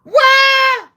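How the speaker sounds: high shouted what; mock shout. Trump shouting at Elon in mock disbelief, high shout. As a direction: yelp it in outrage.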